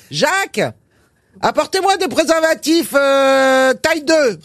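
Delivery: pharmacist calling out. Retold or imitated. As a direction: shout for Jacques across the shop. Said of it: "elle crie à son préparateur".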